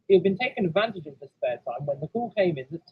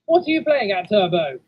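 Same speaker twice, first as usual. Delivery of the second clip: raised, loud driver's call; 3 semitones higher, 6 dB louder than usual. Acted. Driver demands answers from his stalled engine, calling out. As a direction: call out sharply, high then falling. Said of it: "his driver called out".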